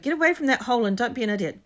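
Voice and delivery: loud, high, rapid scolding; angry outburst. A narrator's reading. Ron ordering Neville away from the hole, exploding. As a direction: snap it out fast and loud. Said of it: "Ron exploded".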